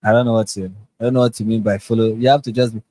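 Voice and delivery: ordinary talk, nothing marked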